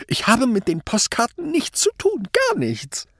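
very high, strained, losing voice at the end; desperate denial. A narrator's reading. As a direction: plead innocence until the voice gives out. Accused man desperately denies involvement. (verzweifeltes, gepresstes Leugnen)

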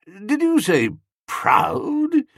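slow, high-rising disbelief; incredulous. A narrator's reading. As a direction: query the last word slowly, aghast. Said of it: "an incredulous note in old Bassett's voice".

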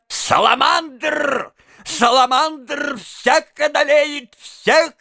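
slow, high, drawn-out shout; furious roar. A narrator's reading. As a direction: roar it out in a frenzy, stretching the words. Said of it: "заревел конректор Паульман в величайшем бешенстве". (яростный рёв)

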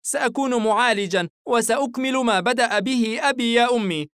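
put-on high, cheerful little boy; eager small boy promising; happy child voice. A narrator's reading. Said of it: "ضحك الصغير".

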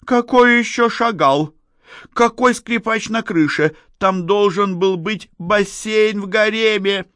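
very high, loud outburst; indignant shouting. A narrator's reading. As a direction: shout in outraged disbelief. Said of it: "закричала Надежда". (возмущённый крик на высоких нотах)

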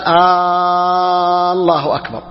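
الله begun with a very long "aaa"; long aaa before "-llahu akbar"; stretched first hamza. Shown as a demonstration. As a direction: hold the opening vowel of الله like a question particle. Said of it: "لا إن مد همزة الله".

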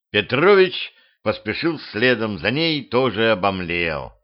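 ordinary delivery

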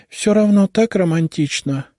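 high, dreamy and breathless; dreamy sigh. A narrator's reading. Sister sighing over the romantic abduction, dreamy. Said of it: "с придыханием произнесла Наташа".

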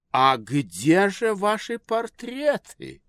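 high, slow, puzzled lady; society lady voice. A narrator's reading. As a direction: ask like a fussy society lady. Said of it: "сказала дама".

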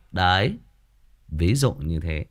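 ordinary talk

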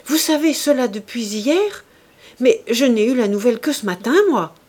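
high, loud, fast indignation; indignant. Acted. The chevalier protesting angrily to the countess. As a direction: rush out the protest, offended. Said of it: "un geste d'étonnement et de colère".

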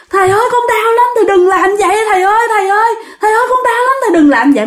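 high-pitched, insistent begging; acted wailing in pain. Tormented ghost begs the master to stop, wailing. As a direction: wail for mercy on a high pitch. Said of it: "Mà gào thét tôi ghê lắm".

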